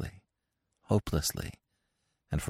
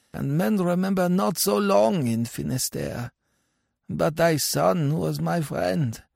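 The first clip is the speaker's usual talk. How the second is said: dead villager; 12 semitones higher than usual. Acted. Dead neighbour tells a father of his son, high and measured. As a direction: answer from the next grave in a raised, measured voice.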